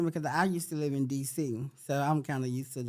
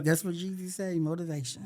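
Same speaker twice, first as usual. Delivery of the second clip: ordinary delivery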